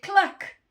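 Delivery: high, loud sharp cluck; punchy hen noise; hen's cluck. A narrator's reading. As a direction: cluck it out abruptly. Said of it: "the hen said".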